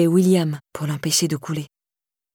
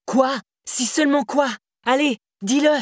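angry shouting; 7 semitones higher than usual. A narrator's reading. Husband shouts back in quarrel.

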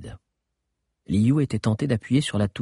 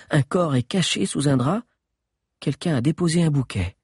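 Agnès voice; 6 semitones higher than usual. Acted. Agnès explains pattern.

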